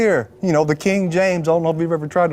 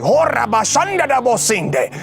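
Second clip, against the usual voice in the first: sudden rush of unknown syllables, pitched up; speaking in tongues; 5 semitones higher than usual. Retold or imitated. Retelling to the congregation, speaking in tongues. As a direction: burst into tongues like it takes you over. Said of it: "I start speaking in different languages".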